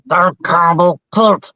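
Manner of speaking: slow, high duck voice in a huff; Donald Duck Wolverine. Retold or imitated. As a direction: grumble it slowly as a cartoon duck. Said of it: "it's just like".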